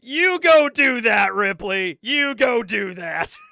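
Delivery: loud, slow, sarcastic brush-off; mock-dismissive Frankie. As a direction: wave her off with exaggerated indifference. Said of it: "And just like".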